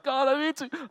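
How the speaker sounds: imitating a struggling smoker's first strained plea; pleading. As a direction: beg God, straining upward. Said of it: "He's like".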